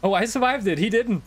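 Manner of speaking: high relieved laughing voice; excited relief. Real, not acted. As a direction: blurt it out in relief. Relieved after surviving.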